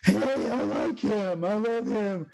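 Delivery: high, gushing fan; excited fan voice. Retold or imitated. Fans meeting the Brack voice actor, high gushing. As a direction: squeal it like a delighted fan. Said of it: "people will go".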